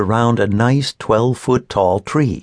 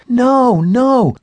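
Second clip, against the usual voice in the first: high, loud protest; a child's urgent "no, no" to stop them; crying out; 13 semitones higher, 5 dB louder than usual. A narrator's reading. Girl stopping her family cutting the tree, loud and high. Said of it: "cried Emma".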